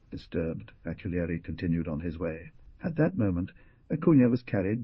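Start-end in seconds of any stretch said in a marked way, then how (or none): none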